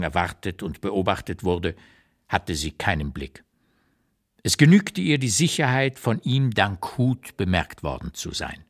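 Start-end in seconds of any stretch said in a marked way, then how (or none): none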